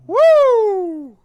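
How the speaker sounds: loud, very high whoop; cop's whoop. Retold or imitated. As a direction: whoop it out like a cop who has caught you. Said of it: "And the cop goes".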